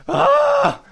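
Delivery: high, loud screamed ah; mock drunken scream. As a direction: let out a wild drunken yell. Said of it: "screaming at the top of our lungs".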